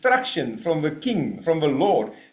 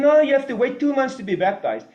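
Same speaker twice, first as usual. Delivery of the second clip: mock dismissive leader; 8 semitones higher, 3 dB louder than usual. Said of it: "And you tell him".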